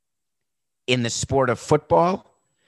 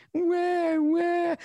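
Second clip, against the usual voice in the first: high-pitched fake crying, "wah, wah"; mock baby wail. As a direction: sneer with a whiny cartoon sob. Mocking the owner's tearful apology.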